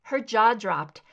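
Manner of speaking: ordinary delivery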